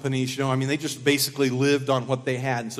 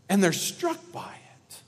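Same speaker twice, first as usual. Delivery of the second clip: ordinary delivery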